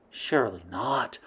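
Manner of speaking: usual speaking voice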